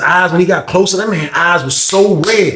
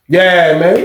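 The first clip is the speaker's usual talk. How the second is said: ordinary talk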